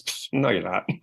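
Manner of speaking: dismissive brain. Retold or imitated. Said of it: "your brain goes".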